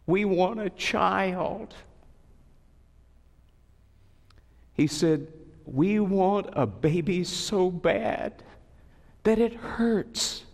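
slow, aching retold plea; a mild hint of tears; tearful plea. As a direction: childless couple pleading with the pastor. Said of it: "they had tears in their eyes".

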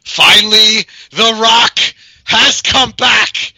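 booming, drawn-out ring announcer. Pretend. Grand self-announced podcast return.

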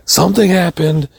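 high, alarmed retold cry; freaking out. Wife bursts in on husband in the shower, freaking out. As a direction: imitate a panicked wife breaking bad news. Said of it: "Steph is freaking out".

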